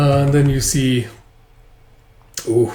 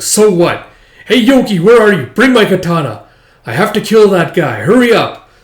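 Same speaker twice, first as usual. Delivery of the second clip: loud, high, furious demands; girl shouting for her katana, louder and higher; angry shouting; 7 semitones higher, 8 dB louder than usual. Acted. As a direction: bark orders, desperate to go kill.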